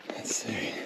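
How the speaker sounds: hushed whisper while sneaking up. Real, not acted. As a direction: breathe the words, barely voiced. Sneaking up on a cave.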